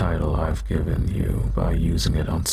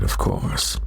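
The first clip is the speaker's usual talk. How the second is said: normal talking voice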